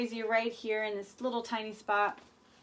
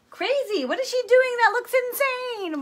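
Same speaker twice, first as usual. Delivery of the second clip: much higher, louder, incredulous; mock shocked onlooker; 11 semitones higher, 6 dB louder than usual. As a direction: play a scandalised viewer gasping at the paint.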